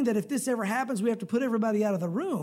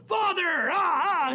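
mocking angels; 6 semitones higher, much slower than usual. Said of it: "the angels going".

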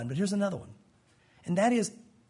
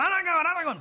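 mock kid refusing, higher-pitched and fast; child's tantrum; 7 semitones higher, faster than usual. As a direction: imitate a child throwing a fit. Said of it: "Even if they wallop on the ground".

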